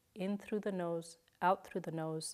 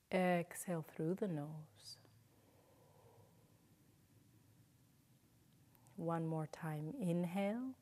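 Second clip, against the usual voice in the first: guided breathing cues; 3 dB quieter than usual. Shown as a demonstration. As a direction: lead the breath gently, leaving room to breathe.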